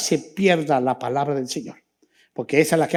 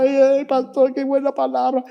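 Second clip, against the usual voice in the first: higher, tearful mock cry of a moved believer; imitating a weeping believer; 6 semitones higher than usual. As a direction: play the moved churchgoer crying out. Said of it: "Empiezan a llorar". (imitando a una creyente que llora)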